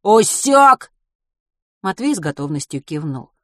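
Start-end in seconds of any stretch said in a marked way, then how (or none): 0.1-0.9 loud, high snapped question; sharp thug bark. Acted. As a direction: snap it at him. Thug barks final question.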